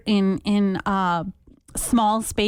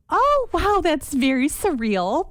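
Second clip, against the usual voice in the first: wry surprise; 6 semitones higher, slower than usual. Retold or imitated. Reacting to softball size.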